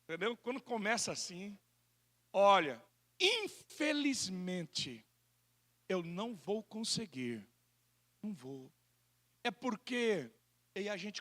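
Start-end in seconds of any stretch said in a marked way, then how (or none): 2.3-8.7 softer, lower, apologetic let-down; a polite guest softening the same refusal; polite excuse. Shown as a demonstration. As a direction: ease into it gently, sorry but can't. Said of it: "Fala assim".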